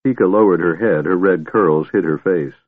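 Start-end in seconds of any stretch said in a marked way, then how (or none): none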